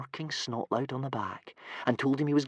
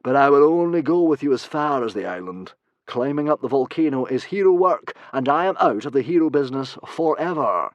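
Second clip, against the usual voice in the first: louder grand hero; weary ex-hero refusing; lightly voiced pompous hero; Humongous; 5 semitones higher, 8 dB louder than usual. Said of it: "said Humongous".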